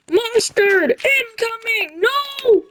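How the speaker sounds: high, slow hammed-up alarm; mock warning cry. Kids warned of the mustard attack, high slow mock alarm. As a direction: stretch the warning out then squeal. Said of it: "a warning".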